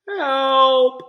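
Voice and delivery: high, loud tearful call for help; sniffling cry for help. A narrator's reading. Lost girl on a rock calling for help, tearful. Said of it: "she sniffed".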